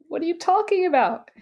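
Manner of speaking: high, baffled rising-then-falling question; incredulous. Retold or imitated. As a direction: imitate a husband who can't believe his ears. Husband baffled by her claim.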